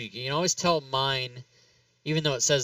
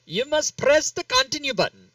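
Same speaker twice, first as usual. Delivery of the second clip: higher, louder imitation of an Indian English accent; imitated Indian accent; 13 semitones higher, 6 dB louder than usual. Retelling a call-center agent on the phone, lightly imitated Indian accent. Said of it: "she was saying it in her accent".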